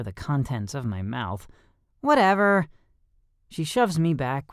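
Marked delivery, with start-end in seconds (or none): 2.0-2.7 dismissive. A narrator's reading. Shoving him back.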